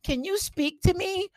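very high, slow plea; mock needy girlfriend. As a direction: plead sweetly like a clingy partner. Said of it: "She calls you".

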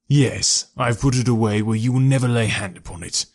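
ordinary delivery

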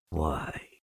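low, soft donkey voice; understated wonder, kept low; Eeyore, quietly excited. A narrator's reading. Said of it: "he became quite excited".

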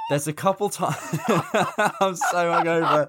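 cracking up mid-sentence; laughing. Real, not acted. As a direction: giggle through the words.

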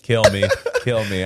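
laughing. Real, not acted. Laughing repeat.

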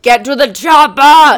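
put-on loud, slow Austrian accent; Arnold Schwarzenegger impression. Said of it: "the famous line of".

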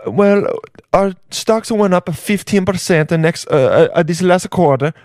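hammed-up Italian boss; Jeff Bezos with Italian accent. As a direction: address shareholders in mock Italian English. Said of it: "he had an Italian accent".

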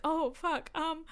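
high, hesitant customer voice; flustered. Retold or imitated. Intimidated customer orders from rude donut lady, flustered.

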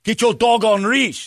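high, slow imitation Korean accent; mock Korean accent. Man scolding a dog owner, mock Korean accent. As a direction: order it curtly in an exaggerated Korean accent. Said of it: "a Korean man with an accent".

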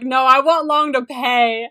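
mock demand. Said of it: "you're like".

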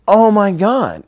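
loud, high exclamation; sudden realization. Retold or imitated. Skater suddenly spots why the trick is failing, astonished exclamation. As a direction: burst out with it, astounded.

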